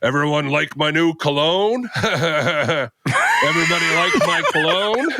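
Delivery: boozy loudmouth repeating his gag with a laugh; obnoxious drunk voice. Retold or imitated. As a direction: crow it out like a tipsy joker, laughing at your own joke. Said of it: "the obnoxious Ford Drinks Deep voice".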